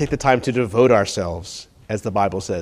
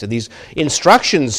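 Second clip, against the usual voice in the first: normal talking voice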